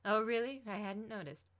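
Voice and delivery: mock surprise, clearly unimpressed; sarcastic. A narrator's reading. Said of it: "with a sarcastic expression".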